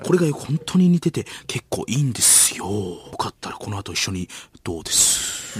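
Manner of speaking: 軽部. Acted. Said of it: "軽部アナと遭遇する".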